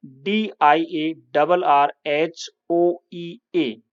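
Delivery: letter by letter, deliberate; British spelling, spelled slowly. Shown as a demonstration. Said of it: "diarrhoea".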